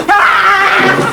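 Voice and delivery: sudden laughing yelp; startled yelp. Acted. Music teacher startled on the phone, a laughing yelp. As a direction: jump with a loud little cry.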